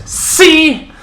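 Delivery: loud, high exclamation of proof; triumphant accusing elder. Acted. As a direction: shout it to the crowd as proof. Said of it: "as he goes".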